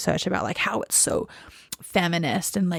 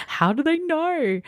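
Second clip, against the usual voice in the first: mock amazement; 9 semitones higher than usual. Real, not acted. Amazed at astrology app.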